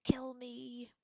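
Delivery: high, very loud agonized scream; desperate cry at the top of the voice; anguished yell. A narrator's reading. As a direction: yell it out in full agony. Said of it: "than he yelled".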